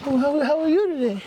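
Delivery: very high, stammering squirrel voice; innocent little squirrel. Acted. Squirrel making small talk with a bear, high timid voice. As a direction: stammer politely in a tiny high voice. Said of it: "And Mister Squirrel goes".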